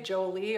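"Jolie" with a hard English J; old hard-J pronunciation. Shown as a demonstration. Painter showing her old mistake, "Jolie" said joe-lee. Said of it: "I used to say Jolie".

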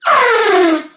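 high, loud lip-blown trumpeting; elephant trumpet. Shown as a demonstration. Librarian showing children her special skill, loud elephant trumpet. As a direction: lips together, blow out an elephant blare. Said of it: "Let's try it again".

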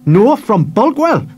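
put-on slow, high boy's voice standing firm; stout. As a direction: defend your hometown proudly. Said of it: "he said stoutly".

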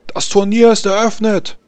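hoch und laut verkünden; lauter Ausruf. Acted. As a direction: ruf die Eröffnung über die ganze Arena. Turniermarschall eröffnet das Turnier. (Turniermarschall eröffnet das Turnier mit lautem Ruf)

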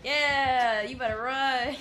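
loud, high cheering yell; hype shout. Real, not acted. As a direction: shout her on to run. Cheering teammate being chased.

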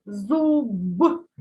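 slow зуб with a heavily voiced final b; voiced final consonant. Shown as a demonstration. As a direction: stretch зуб and release a clear "b". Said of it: "Вимовляємо дзвінко".

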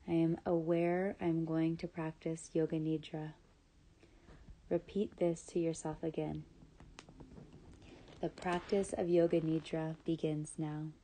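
ordinary talk

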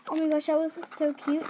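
ordinary delivery

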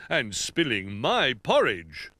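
slow, emphatic bear voice; indignant Old Bear. Acted. Old Bear finds his chair disturbed, slow indignation. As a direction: draw out each accusing word.

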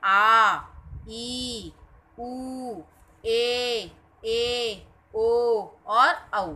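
आ, ई, उ, ए, ओ, औ each held about twice as long; long vowel demo. Shown as a demonstration. Teacher drawing out each long Hindi vowel for the class. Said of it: "दुगना समय लगता".

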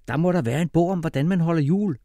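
high, cheerful father; glad. A narrator's reading. Father sure a library book will help, cheerfully high. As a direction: keep it high and pleased. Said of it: "sagde han glad".

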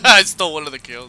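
loud laughing brag. Real, not acted. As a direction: laugh while owning up. Laughing, stole a kill.

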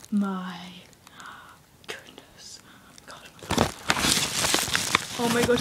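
slow whispered amazement; a slow whisper of awe over the new pet; awed whisper. Real, not acted. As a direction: whisper it, barely breathing. Hushed awe holding the new pet.

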